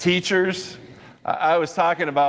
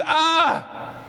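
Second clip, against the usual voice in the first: high acted yelp of dismay; imitated high scream of dismay; mock horrified cry. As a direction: cry out as if shocked by the sight. Imitated shock at the scene.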